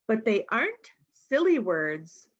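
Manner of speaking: plain reading voice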